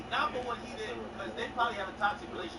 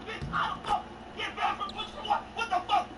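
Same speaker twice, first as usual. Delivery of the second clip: panicked; 11 semitones higher than usual. Retold or imitated. Retelling his panicked reply.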